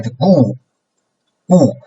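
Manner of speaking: GU falling sharply from high to low; fourth tone. Shown as a demonstration. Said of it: "and then suddenly low".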